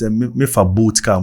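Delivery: ordinary delivery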